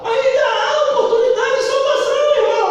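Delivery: high, loud preaching cry; fiery preaching. Acted. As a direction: preach it with full force, rising. Preaching at full force.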